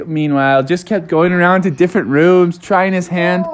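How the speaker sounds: plain reading voice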